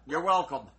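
usual speaking voice

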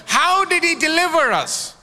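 usual speaking voice

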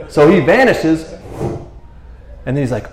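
normal talking voice

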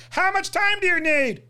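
high, put-on questioning voice; mock the dialogue in a high voice; high-pitched parody of a movie question; mimicked film line.

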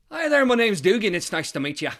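high, loud, eager hello; chirpy young lad introducing himself; young Irishman greeting. Acted. Young man greeting an old stranger at the bar, eager and high.

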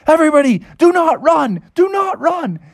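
high, urgent warning call; emphatic high-pitched announcement; alarmed park ranger. Acted. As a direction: order the crowd in a high cartoon voice. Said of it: "the park ranger said".

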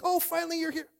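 high-pitched, gushing fake relief; mock excitement. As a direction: act out fired-up disciples, hammed-up. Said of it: "so excited and so fired up".

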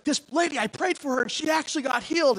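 high, breathless good-news delivery; excited report. Retold or imitated. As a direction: spill the amazing news to the team. Excitedly tells team about healing.

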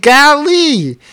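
high, loud exasperated "Golly". Retold or imitated. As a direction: throw it out in loud disbelief. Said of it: "I was like".

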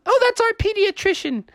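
very high, breezy character voice; chirpy explanation. Acted. Answering who Venkman is, chirpy. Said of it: "He's like".

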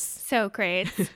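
"Socrates" echoed in two syllables, "so crates"; mispronounced Socrates. Retold or imitated. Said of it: "they call him Socrates".

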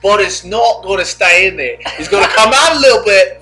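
hammed-up wildlife presenter, rising then settling; Steve Irwin. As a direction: narrate it like a wildlife documentary. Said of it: "I just imagine you steamer winning her".

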